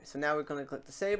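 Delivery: usual speaking voice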